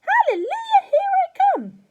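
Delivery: witchy grandma hollering; triumphant shout. A narrator's reading. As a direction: crow in wild triumph. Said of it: "she shouted, finding her voice".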